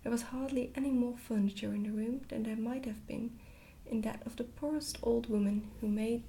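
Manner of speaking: ordinary delivery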